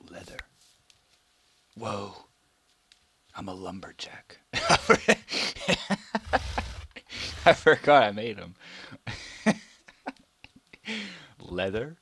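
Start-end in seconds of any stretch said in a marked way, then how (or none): 4.4-7.4 high, loud burst of laughter; sudden laughing fit. Real, not acted. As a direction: crack up hard.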